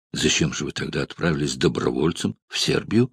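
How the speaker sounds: plain reading voice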